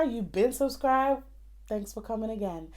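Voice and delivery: ordinary delivery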